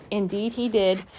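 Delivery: plain reading voice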